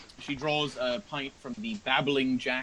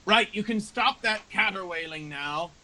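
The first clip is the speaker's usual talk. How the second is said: put-on old patron scolding; 9 semitones higher, 3 dB louder than usual. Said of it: "he leans over and he says".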